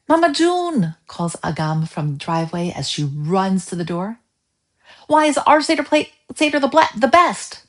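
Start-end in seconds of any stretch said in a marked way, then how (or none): 0.1-1.0 high, loud call across the driveway. A narrator's reading. Girl running in from the driveway, calling loudly. 5.1-7.6 put-on high, loud eager kid question; excited child. A narrator's reading. Girl asking her mother about the Seder plate, high and eager.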